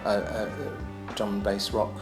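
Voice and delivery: usual speaking voice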